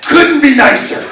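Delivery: usual speaking voice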